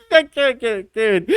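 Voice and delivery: high, laugh-choked broken words; squeaked out while cracking up; helpless laughter. Real, not acted. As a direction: give up talking, overcome by giggles. Laughing too hard to finish.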